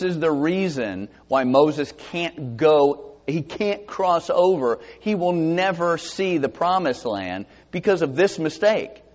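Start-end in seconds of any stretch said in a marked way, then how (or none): none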